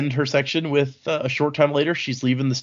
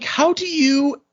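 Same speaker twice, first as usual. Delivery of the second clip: higher, strained question cut off by a pained grunt; mock cry of pain; 11 semitones higher, 5 dB louder, much slower than usual. Stabbed in the leg mid-question, a mock pained cry. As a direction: let the question break into a hammed-up yelp. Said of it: "as she rammed the dagger into his leg".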